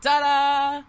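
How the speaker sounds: grand ta-da. Acted. Unveiling a magic trick.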